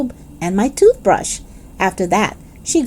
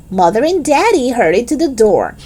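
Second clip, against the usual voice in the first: ordinary delivery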